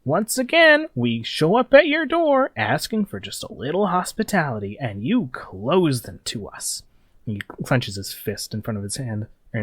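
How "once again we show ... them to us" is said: high, theatrical villain voice drawn out at the end; a sneering boss scolding the locals; smarmy gang leader. Acted. Gang leader taunts the barricade defenders, high theatrical sneer. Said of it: "he's like".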